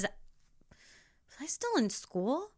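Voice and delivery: slow soft musing question; wondering aloud. Real, not acted. Vlogger trying to remember, slow soft musing. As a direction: drift off as if asking yourself.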